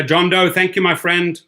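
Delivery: usual speaking voice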